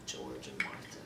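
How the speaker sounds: soft, low and defeated; hushed, bleak confession; quiet self-blame. Acted. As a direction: sink toward a whisper in sorrowful resignation. Acted monologue, bitter self-blame.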